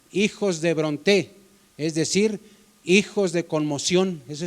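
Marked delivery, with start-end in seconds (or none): none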